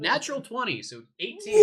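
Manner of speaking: high loud announcement of the roll; excited. Real, not acted. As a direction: call out the number thrilled.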